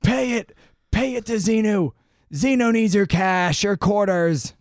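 hammed-up money-hungry preacher; greedy cult preacher. Voicing greedy Scientology preacher.

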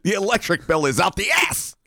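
very high mock outrage; exasperated rant. Man ranting about his camera costs, mock outraged. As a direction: exclaim it in hammed-up exasperation.

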